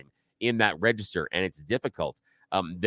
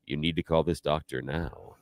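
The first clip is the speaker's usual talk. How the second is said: low, softer male announcer read; deep baritone voiceover; 6 semitones lower, 6 dB quieter than usual. Shown as a demonstration. Demonstrating what male voiceover artists do, deep baritone. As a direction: drop down into a trustworthy voiceover voice. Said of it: "they look for the deeper baritone".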